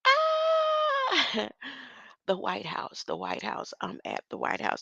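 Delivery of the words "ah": shriek. Real, not acted. Laughing shriek after story.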